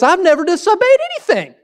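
high, whiny mock of a smug son. Preacher mocking the older son's boast, in a high whiny voice. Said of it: "most ridiculous statement I've ever heard".

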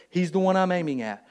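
usual speaking voice

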